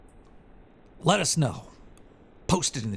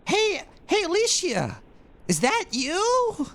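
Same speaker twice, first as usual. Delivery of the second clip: put-on very high, loud squeaky girl voice; hammed-up very high girly call for Alicia; mock girly message; 4 dB louder, slower than usual. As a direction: call out like a giddy anime girl. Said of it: "They said".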